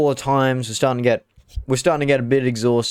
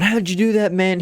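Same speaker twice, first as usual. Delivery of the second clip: amazed question; 8 semitones higher than usual. Retold or imitated. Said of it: "We're going".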